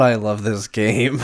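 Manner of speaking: ordinary talk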